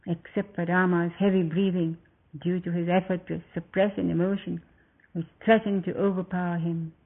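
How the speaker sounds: plain reading voice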